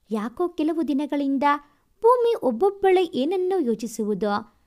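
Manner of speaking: ordinary delivery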